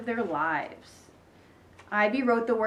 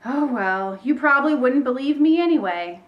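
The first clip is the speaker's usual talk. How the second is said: louder, theatrical resignation, rising after "oh, well"; sighing resignation; 4 semitones higher, 6 dB louder than usual. A narrator's reading. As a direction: play up the wounded sigh to bait them. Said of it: "stopped pointing and sighed".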